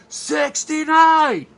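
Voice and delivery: very high, drawn-out shout; full-voiced, stretched mock crowd holler; imitated crowd yell. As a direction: yell it out like a whole audience. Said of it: "the audience, of course, yells".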